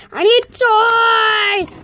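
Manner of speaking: drawn-out, insistent whine; whiny demand. Acted. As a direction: demand it with a long wail on the last word. Demanding toys in the bath.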